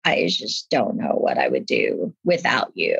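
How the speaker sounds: a hint of a crying husband, low and hushed; tearful husband. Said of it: "he was totally tearing up".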